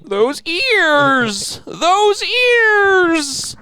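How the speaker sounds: very high, drawn-out comic outcry; mock exclaiming. Marvelling at the cop's gigantic ears, high comic outcry. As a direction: wail it high, stretching the last word.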